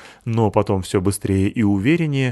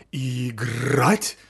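fading dog voice; 3 semitones higher, 4 dB quieter than usual. Acted. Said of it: "удалялся вслед за ним голос Маламута".

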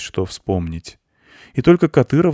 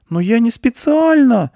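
very high, louder protest; indignant girl; 7 dB louder than usual. A narrator's reading. Girl defends herself to the goat, indignantly. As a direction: protest hotly in a girl's high voice. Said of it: "возмутилась Аня".

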